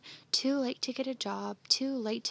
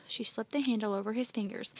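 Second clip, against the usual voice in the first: ordinary delivery, nothing marked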